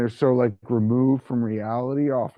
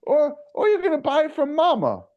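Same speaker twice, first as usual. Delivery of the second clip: high, sing-song ad pitch voice; hamming up a folksy fried-chicken saleswoman; mock Popeyes ad woman; 7 semitones higher than usual. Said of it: "this woman who reminds you of, like, those racist Popeyes commercials".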